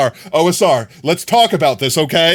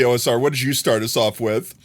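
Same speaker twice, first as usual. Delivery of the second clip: normal talking voice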